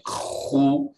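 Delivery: Khu as a dry, voiceless scratch in the throat; scratchy khu. Shown as a demonstration. Teacher showing a plain scratching Khu, not gargled. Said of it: "Straight up scratching".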